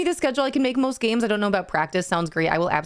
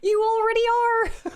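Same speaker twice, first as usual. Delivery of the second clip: squealing laugh. Real, not acted. Streamer repeats reply to Grandma Fish, squealing with laughter. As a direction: squeal it out, delighted.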